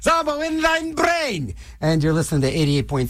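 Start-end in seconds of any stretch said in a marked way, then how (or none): 0.0-1.5 high, slow, eerie intoning; spooky station ident. Acted. DJ opening his show with an eerie slow intone. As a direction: croon the show name like a weird spell. Radio DJ show catchphrase opener.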